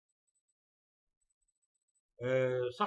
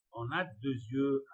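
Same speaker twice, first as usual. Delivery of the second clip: slow careful reading; 4 dB louder, much slower than usual. Shown as a demonstration. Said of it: "reading French answer slowly".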